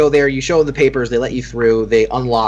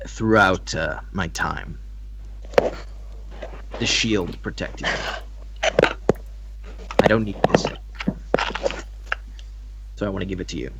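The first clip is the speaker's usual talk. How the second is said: solemn departing protector; 5 semitones lower, 9 dB quieter than usual. Acted. Protector gives his shield to her, low and solemn. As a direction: speak quietly and deliberately, handing on a keepsake. Game master voices gifting character.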